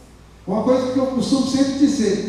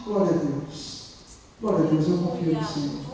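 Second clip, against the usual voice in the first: low, hushed praise of a wounded believer; very soft voice; 6 semitones lower, 7 dB quieter than usual. Acted. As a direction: pray it faintly, well below preaching pitch. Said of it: "ele continua falando bem baixinho".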